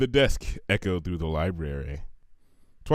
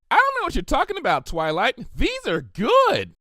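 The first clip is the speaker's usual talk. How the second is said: high, bouncy and cheerful; bubbly pony voice; 5 dB louder than usual. A narrator's reading. Pinkie gushes to Twilight about her cookies, high and bubbly. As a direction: gush like a sugar-loving party pony.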